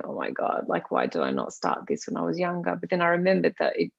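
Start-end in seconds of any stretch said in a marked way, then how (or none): 0.0-2.8 low, hushed inner worry, almost whispered; quiet self-doubt. Retold or imitated. Recalling her insecurity about starting late, hushed worry. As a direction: mutter it to yourself like a worried thought. Anxious self-talk retold.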